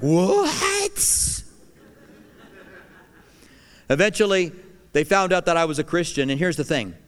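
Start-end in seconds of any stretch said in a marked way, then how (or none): 0.0-1.4 high, far-off squeak of disbelief; a shocked coworker's What?; incredulous coworker. Retold or imitated.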